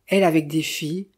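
usual speaking voice

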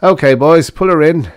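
usual speaking voice

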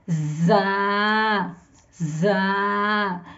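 za read firmly with teeth closed. Shown as a demonstration. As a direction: bite down on जा. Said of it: "इसको हम थोड़ा सख्ती से पढ़ेंगे".

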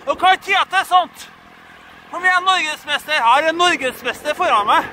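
high, loud calling out; excited shouting. Real, not acted. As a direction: yell it out to riders ahead.

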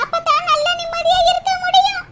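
ordinary talk

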